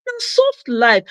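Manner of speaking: na soft life lifted high and snapped; bright emphatic repeat. Shown as a demonstration.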